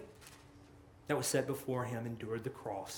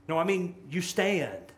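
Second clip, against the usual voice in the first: ordinary delivery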